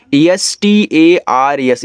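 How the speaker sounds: slow, letter by letter; spelling out. Shown as a demonstration. Teacher spelling a word for beginners, slow spelling out. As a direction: spell it out slowly for learners.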